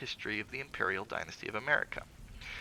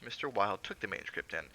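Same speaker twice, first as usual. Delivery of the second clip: ordinary delivery, nothing marked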